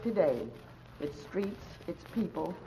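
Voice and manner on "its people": usual speaking voice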